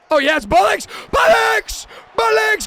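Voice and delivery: high, loud Bullocks cry; erupting into a full shout on the repeat; excited shout. Real, not acted. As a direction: scream the name like a touchdown call. Touchdown build-up.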